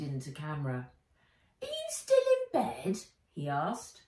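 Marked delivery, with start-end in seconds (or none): 1.6-3.0 put-on very high cheeky boy; teasing young boy. Henry's voice.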